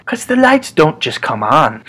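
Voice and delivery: ordinary talk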